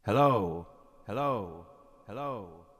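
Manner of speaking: hello fading into echo delay; echoing hellos dying away. Shown as a demonstration. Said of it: "I'll put on some delay here".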